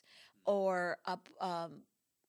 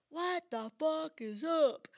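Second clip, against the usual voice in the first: slow, much higher, hammed-up guru greeting; guru's greeting; 8 semitones higher, 5 dB louder, slower than usual. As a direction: stretch it out like a cheeky guru. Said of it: "he said".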